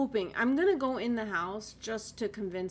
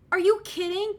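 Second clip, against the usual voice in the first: disbelief; 8 semitones higher, 8 dB louder than usual. Real, not acted. Repeats disbelief at the find.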